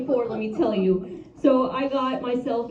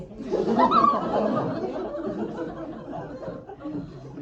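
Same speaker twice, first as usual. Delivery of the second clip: airy wheezing laugh, much higher; breathless laugh. Real, not acted. Comic wheezing at her own insult, airy laugh.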